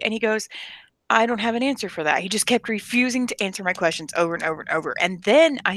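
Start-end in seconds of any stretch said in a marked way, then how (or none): none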